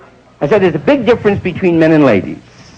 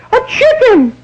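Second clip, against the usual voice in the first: high falsetto outrage; shocked wife; slower than usual. Acted. Wife catches husband with a chicken, high shriek.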